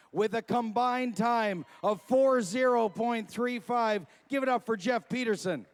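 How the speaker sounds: booming event announcer introductions. Acted. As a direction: sell each name to the crowd.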